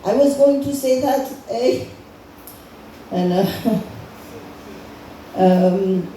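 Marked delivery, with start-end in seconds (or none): 0.0-1.8 put-on high-pitched comic Canadian accent. Comic voices Canadian leader at summit, high Canadian accent. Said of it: "Canadian guy's like".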